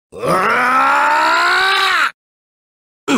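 long drawn-out yell; battle scream. Acted. Fighter crying out mid-battle, extended scream. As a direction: hold one long raw scream.

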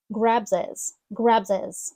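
grabs his linked as "grab-zez"; linked words. Shown as a demonstration. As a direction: run "grabs his" together with no h. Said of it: "Ми поєднуємо ці два слова".

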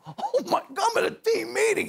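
high-pitched inner freak-out; awestruck panic. Retold or imitated. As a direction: act out giddy disbelief in a high voice. Acts awe inside locked meeting.

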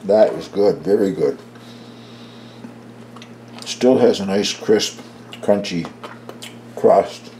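talking with a mouth full of bread; words round a bite of roll. Real, not acted. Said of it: "I'm talking with my mouth full".

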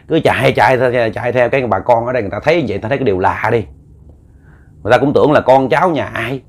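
usual speaking voice

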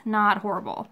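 ordinary talk, nothing marked

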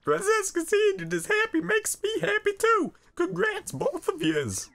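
very high squeaky congratulations; happy cartoon sidekick. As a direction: cheer the couple in a silly falsetto. Voices a happy character congratulating.

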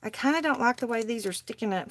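usual speaking voice

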